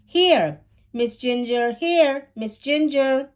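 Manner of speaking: put-on boy calling his lost cat. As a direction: call out to the cat up the tree. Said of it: "Zach called out".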